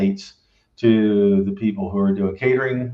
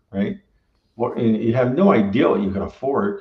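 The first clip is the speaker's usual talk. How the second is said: normal talking voice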